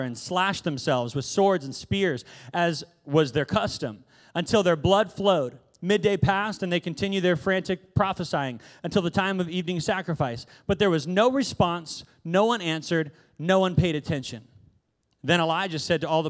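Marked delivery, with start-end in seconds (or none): none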